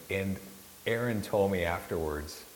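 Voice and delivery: normal talking voice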